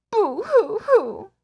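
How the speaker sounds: slow, high mock boo-hoo; fake crying. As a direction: ham up a pretend sob. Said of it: "Now let's pretend to cry".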